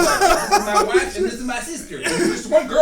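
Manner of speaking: high, laughing mock introduction; imitating the Romeo actor. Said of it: "He goes".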